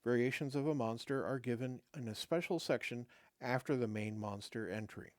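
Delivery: ordinary delivery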